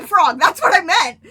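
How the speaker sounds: loud, high burst of realization; excited shout. Real, not acted. Speaker suddenly finding the word they meant, shouting excitedly. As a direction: shout the correction out.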